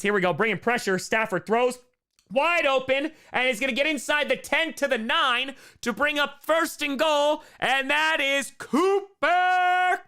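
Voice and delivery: excited call building to a loud, high finish; play-by-play. Real, not acted. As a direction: build the call into a long, soaring name.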